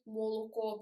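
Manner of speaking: "молоко" as mo-lo-KO with full O's; pronounced as written. Shown as a demonstration. Teacher reading "молоко" as we write it, all O's unreduced. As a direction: give each O in "молоко" its round letter sound. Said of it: "properly as we write it".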